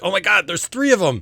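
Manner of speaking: high, loud shout of shock; acted startled outburst; alarmed cry. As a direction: shout a panicked warning. Acted creature spotting three intruders.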